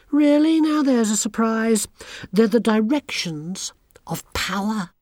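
put-on high, smug and sneering girl; haughty schoolgirl. A narrator's reading. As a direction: look down your nose at everyone. Anagramma mocks Tiffany.

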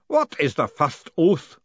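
slow, loud ritual question; booming ceremonial challenge; booming demand. A narrator's reading. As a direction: demand it grandly, drawn out. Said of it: "the booming voice demanded again".

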